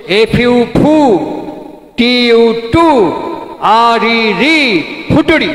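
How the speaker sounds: slow drawn-out spelling chant ending in ফুটুরি; imitated rote spelling of ফুটুরি, long pauses between syllables; syllable-by-syllable recitation. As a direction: spell out ফুটুরি in separate stretched chunks, like schoolboys memorising. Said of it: "কেটে কেটে syllable ভাগ করে করে উচ্চারণ করো".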